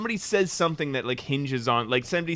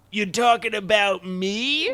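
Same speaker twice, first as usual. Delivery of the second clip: slow, drawn-out, higher-pitched mimicry; mock incredulous retort; 6 semitones higher, slower than usual. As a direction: imitate a cartoon boy's indignant question, stretched out. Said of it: "and he goes".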